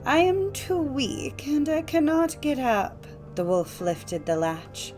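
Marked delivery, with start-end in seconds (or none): 0.0-3.1 put-on high, slow old-lady voice; ailing granny in a raised pitch; weak grandmother. A narrator's reading. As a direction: call feebly from bed, drawn out and high. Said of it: "called out the grandmother".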